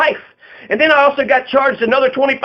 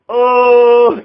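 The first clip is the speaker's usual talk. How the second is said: loud, drawn-out dopey "oh" on one held note; mock dumb guy; 5 dB louder than usual. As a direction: imitate a dimwit's long, dumbstruck reaction. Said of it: "like a dumb fuck".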